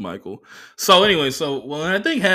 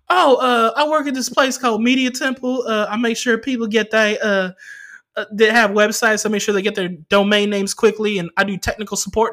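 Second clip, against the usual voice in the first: much higher, louder fake phone-support voice; customer service voice; 11 semitones higher, 6 dB louder than usual. Comic faking a chipper tech-support voice for the driver. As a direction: put on a polite tech-support voice. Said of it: "I'm like".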